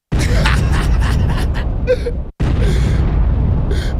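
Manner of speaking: big unvoiced cackle, thrown back high; loud laugh. Real, not acted. Streamer cracking up at chat, loud airy laugh. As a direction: burst out laughing at your own joke.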